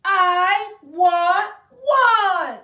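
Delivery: very slow, loud, high wail; tantrum wail. Acted. Child repeating her demand to her mother, slow high wail. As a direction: howl it out like a toddler.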